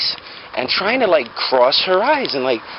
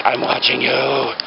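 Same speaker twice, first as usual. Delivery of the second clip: slow creepy mock voice; creepy staring patient; slower than usual. Patient staring at the dentist, slow mock creepy. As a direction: stare and say it slowly, mock creepy. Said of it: "just like".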